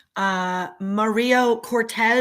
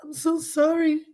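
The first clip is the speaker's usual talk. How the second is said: crying apology; 6 semitones higher than usual. Real, not acted. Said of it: "Don't you apologize for nothing".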